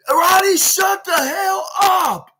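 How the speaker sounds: drawn-out, very high mock shout; Adam Cole yelling. Adam cutting off Roddy's complaints, loud exasperated mock yell. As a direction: yell it slowly, hammed-up fed-up outburst. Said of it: "for him to yell at me".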